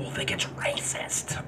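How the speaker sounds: hushed whisper from the wife; wife whispering a worried warning. Retold or imitated. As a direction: whisper it so nobody hears. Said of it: "She said".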